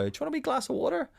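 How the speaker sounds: very high, quick offering voice; imitated caring partner. Partner pouring water offers a glass, high imitated voice.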